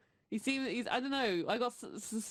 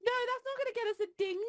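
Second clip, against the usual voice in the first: high, sing-song ding-a-ling; very high silly voice; 12 semitones higher than usual. Acted. Squeaky voice, player cheering on doing it for the ding. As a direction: chirp it way up, playful.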